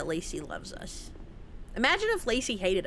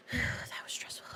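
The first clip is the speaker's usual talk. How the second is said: whispered relief; 11 dB quieter than usual. Real, not acted. After tense fight.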